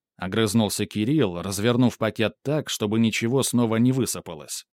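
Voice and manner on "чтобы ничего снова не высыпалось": usual speaking voice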